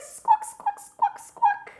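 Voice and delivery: loud, very high bird squawks; piercing pretend parrot noise. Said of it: "lots of animal sounds in this song".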